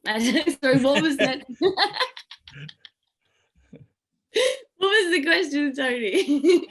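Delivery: can't stop laughing while asking; real laughter bubbling through the question; laughing through words. Real, not acted. Giggling, loses track of question.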